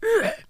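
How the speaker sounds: sharp choked cry as the rope bites; a startled gasp of pain; pained grunt. Acted. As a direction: caught by the neck, a high choked yelp. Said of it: "という声に重ねて".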